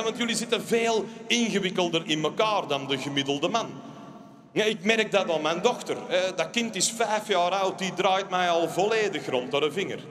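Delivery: ordinary delivery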